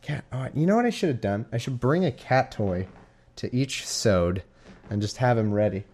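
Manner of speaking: ordinary talk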